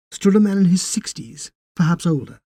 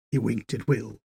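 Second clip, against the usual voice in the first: plain reading voice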